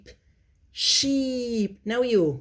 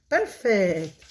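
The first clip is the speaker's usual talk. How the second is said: normal talking voice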